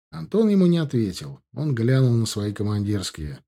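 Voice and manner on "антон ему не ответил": ordinary talk, nothing marked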